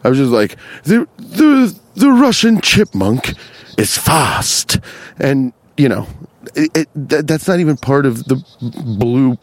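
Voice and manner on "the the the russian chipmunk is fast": higher, breathy nature documentary voice; hushed, awed wildlife narration; high mock documentary narrator; David Attenborough. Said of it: "trying to do David Attenborough".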